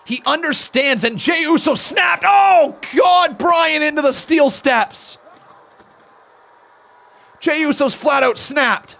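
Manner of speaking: rising excited play-by-play; wrestling commentator. Acted. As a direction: build from calm to a loud yell. Live call as Jey snaps.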